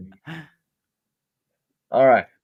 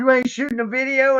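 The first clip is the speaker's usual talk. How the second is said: mock indignant; 8 semitones higher than usual. Wife's indignant question retold.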